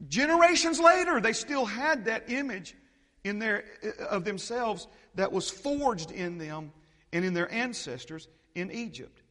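high, loud preaching cry; preacher hitting the peak; preaching shout. Acted. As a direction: belt it out from the pulpit. Preaching climax, much louder.

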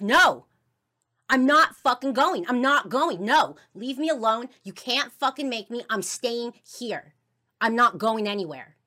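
loud, high-pitched defiant shouting; angry refusal. Retold or imitated. Child refusing to leave with mom, angry shouting. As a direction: shout back and stand your ground. Child defies mother in retold fight.

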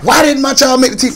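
high, hammed-up all-caps yell; mock yelling. Angry parent demanding why child was cut, mock yell. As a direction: play the furious parent for laughs. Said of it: "Definitely yelling".

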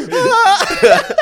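high, loud imitation of a screaming kid; mock scream. Host plays the kid fallen on ice, mock screaming. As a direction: shriek like a fallen child, played for laughs. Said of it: "We also yelled a lot".

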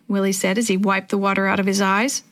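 ordinary delivery, nothing marked

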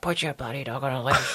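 lightly acted telling-off; imitated complaint. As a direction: imitate an indignant stranger at the park. Voices complaining park-goers.

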